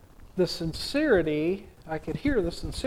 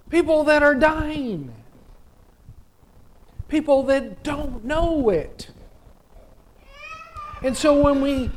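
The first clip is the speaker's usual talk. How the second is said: rising, louder preaching build; 8 semitones higher, 5 dB louder than usual. Acted. Preacher to the congregation about love, rising preaching. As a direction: preach it home, lifting toward the climax.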